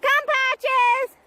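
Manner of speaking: high, loud call across the park; recall call. Real, not acted. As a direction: call the dog in.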